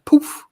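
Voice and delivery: sudden high, loud "poof"; vanishing "poof". Shown as a demonstration. Co-host showing how Armstead disappeared, sudden vanishing poof. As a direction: pop the word out like a puff of smoke.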